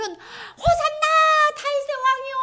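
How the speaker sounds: high, slow drawn-out acclaim; cheering crowd. Acted. People welcoming Jesus as king, high jubilant cheer. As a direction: hail him with a long cheer. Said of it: "환영했다가".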